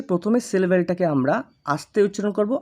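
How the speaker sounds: ordinary talk, nothing marked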